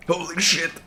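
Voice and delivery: high startled cry; startled exclamation. Real, not acted. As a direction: blurt it out in shock. Sudden scare in game.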